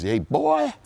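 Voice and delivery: high-pitched imitation Southern drawl; Southern accent. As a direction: call out in a put-on Southern accent. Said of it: "he's got this Southern accent".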